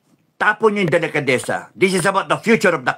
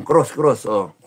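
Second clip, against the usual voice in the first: ordinary talk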